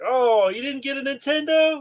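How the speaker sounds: high, teasing fake surprise; dad teasing his son on Christmas morning, very high; mock surprised dad. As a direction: play innocent like a dad hiding the gift.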